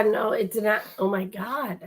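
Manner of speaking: ordinary talk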